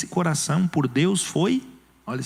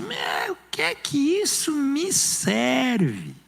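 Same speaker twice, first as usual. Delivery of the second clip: high, slow, shouted sneer; mocking scorn; 9 semitones higher, much slower than usual. Pastor voicing Esau scoffing at his birthright, a slow high mocking shout. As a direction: toss it off like a scoffing brother.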